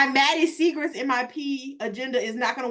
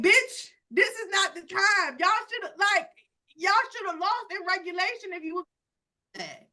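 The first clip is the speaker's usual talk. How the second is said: higher, scolding the team; exasperated fan scolding her team; mock scolding; 6 semitones higher than usual. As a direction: tell them off, half-joking. Yelling at the team in imagination.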